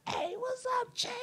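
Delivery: very high, squeaky excited hello; excited greeting. Retold or imitated. Guy behind greeting the teller, squeaky and excited. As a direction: blurt the greeting out in delight. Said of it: "he got kinda loud 'cause he got a little excited".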